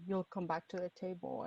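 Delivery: ordinary delivery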